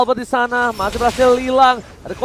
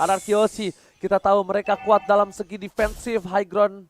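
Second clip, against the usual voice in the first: quiet commentary; 9 semitones lower, 11 dB quieter than usual. Real, not acted. Quiet calm analysis stretch.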